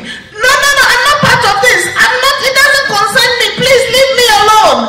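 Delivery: high, fast, loud panicked pleading; screaming rope. A narrator's reading. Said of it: "the rope started screaming".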